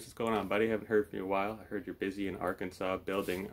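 ordinary talk, nothing marked

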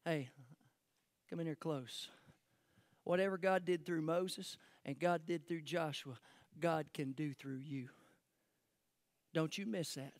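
low, soft, confiding voice; hushed and intimate. Real, not acted. Preacher drawing congregation close for key point, hushed. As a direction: lean in and confide it quietly.

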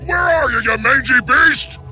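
loud, high holler; rough holler at hidden prey; calling out angrily. Acted. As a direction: yell it out to flush someone out. Outlaw calls out his quarry.

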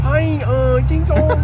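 loud, high mock Chinese accent; hammed-up Chinese accent impression. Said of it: "His Spanish accent is Chinese".

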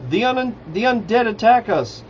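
high, moderately restrained storyteller's shriek; panicked shriek. A narrator's reading. Rainier warns the camp of undead, shrieking. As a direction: cry the alarm as if seeing a ghost. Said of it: "shrieked as if he had seen a ghost".

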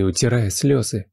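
usual speaking voice

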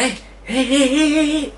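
high-pitched excited "eh, eh" noises; excited squeal. Retold or imitated. Vlogger alerting partner to a discovery in the shop, excited squeal. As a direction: squeal repeatedly to get someone's attention.

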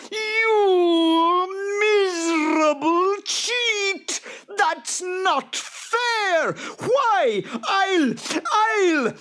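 shrieking, slow, spluttering outrage; enraged boggart. A narrator's reading. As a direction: screech high and loud as a cheated creature. Cheated boggart rages at farmer.

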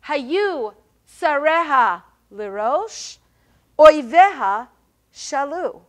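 slow recitation of "Hayu tsareha lirosh, oiveha shalu", three stressed beats, a pause, then two; uneven kina meter. Shown as a demonstration. Teacher reading an example of lamentation meter, uneven three-and-two stresses on "Hayu tsareha lirosh, oiveha shalu". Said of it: "so it's three and two".

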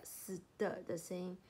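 usual speaking voice

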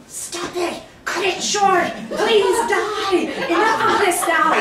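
high-pitched, laughing mock plea; begging. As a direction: beg the other actor to finally die. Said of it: "He kept begging".

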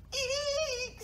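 high-pitched squeak of fright; tiny startled mouse cry. A narrator's reading. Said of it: "squeaked Mouse".

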